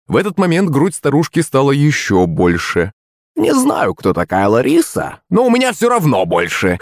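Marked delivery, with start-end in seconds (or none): 3.4-6.8 high boastful crone voice; smug scoff. Acted. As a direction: brag with a smug huff. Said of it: "хмыкнула она".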